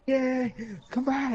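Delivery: high, slow call to come back. Retold or imitated. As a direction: call him back from far off. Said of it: "I was like".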